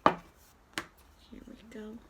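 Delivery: slow breathy whisper. Acted. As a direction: whisper it slowly. Whispered effort.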